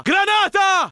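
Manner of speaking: high, loud yell of alarm; shouted warning. Acted. Soldier yells a grenade warning to his squad, alarmed. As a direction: bellow it to warn everyone.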